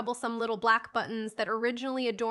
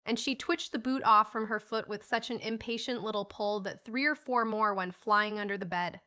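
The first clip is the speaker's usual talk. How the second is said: ordinary delivery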